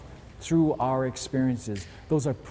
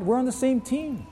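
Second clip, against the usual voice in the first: ordinary delivery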